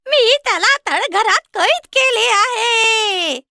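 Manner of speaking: high, slow cackling witch voice; gloating witch. Acted. Witch telling Gretel her brother is locked up, high and slow. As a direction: savour every word.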